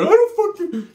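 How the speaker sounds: put-on squeaky high drunk whine; high drunk club guy.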